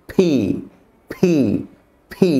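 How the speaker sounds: ফি with a burst of air; aspirated p. Shown as a demonstration. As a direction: lips shut, then a puff. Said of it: "জোরে একটা বাতাস ছাড়ব". (ফি, ঠোঁট বন্ধ করে বাতাস)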